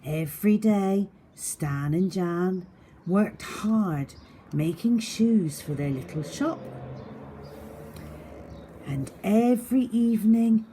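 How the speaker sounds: lively read-aloud for little ones; expressive picture-book reading; story narration. A narrator's reading. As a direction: paint the scene for young listeners. Reads story to children.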